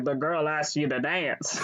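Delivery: lightly voiced security guard; a hint of a guard stepping in. Guard prods him to dance, lightly voiced role. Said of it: "security guard who she plays Gravel with".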